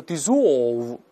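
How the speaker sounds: British dissolve with a rounded o; British pronunciation. Shown as a demonstration. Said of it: "口型非常圆".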